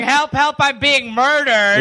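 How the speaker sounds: mild mock yell; mock shouting. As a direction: imitate a call for help, half-hearted. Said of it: "woke Dad up by shouting".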